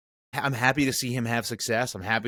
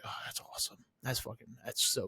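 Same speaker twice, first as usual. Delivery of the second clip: breathy near-whisper of amazement; hushed, almost voiceless excitement; whispered awe; 12 dB quieter than usual. Retold or imitated. Whispering to friends about a great play. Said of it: "I was like".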